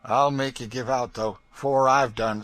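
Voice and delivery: loud raging threat; in a rage. A narrator's reading. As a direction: spit the threat out in fury. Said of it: "said Legree in a rage".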